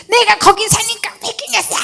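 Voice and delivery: high, defiant mock snap back; indignant retort. Preacher repeating her own outburst, high indignant. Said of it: "바로 이게 나오는 거예요".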